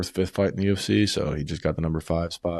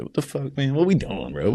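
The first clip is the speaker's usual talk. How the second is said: incredulous; 9 semitones higher than usual. Real, not acted. Incredulous rant about pedophiles.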